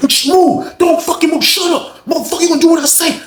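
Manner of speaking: very high strained mock shouting; frantic high-pitched bullying; retold violent commands. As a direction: bark orders mid-fight, high. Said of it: "All you heard was".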